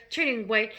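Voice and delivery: ordinary delivery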